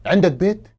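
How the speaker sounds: normal talking voice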